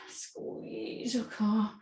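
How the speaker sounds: whispered, drawn-out cue; slow breathy whisper, easing them in. Real, not acted. Instructor cueing a core squeeze.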